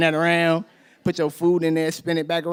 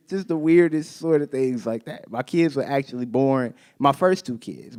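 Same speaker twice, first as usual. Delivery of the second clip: ordinary delivery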